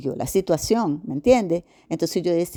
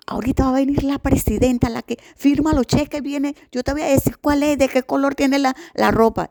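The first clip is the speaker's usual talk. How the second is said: guard's voice; 8 semitones higher than usual. Retold or imitated. Guard whispering a tip.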